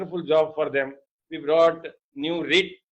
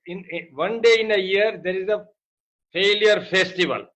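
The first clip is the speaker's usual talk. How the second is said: normal talking voice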